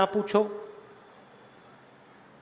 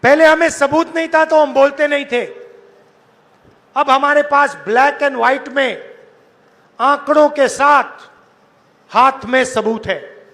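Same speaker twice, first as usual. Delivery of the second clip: shouting to a big crowd; full-voiced rally oratory; booming political rally speech; 3 semitones higher, 5 dB louder than usual. Real, not acted.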